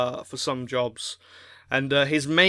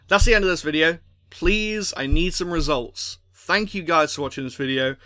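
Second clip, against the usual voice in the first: normal talking voice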